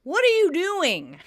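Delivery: building super catching a tenant; gruff demand. Retold or imitated. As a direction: catch someone in the act. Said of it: "Mister Trieger asks".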